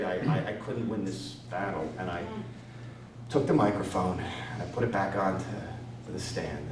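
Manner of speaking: low, soft, crushed; defeated low voice. Retold or imitated. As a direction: speak like it's all over. Crushed after bombing.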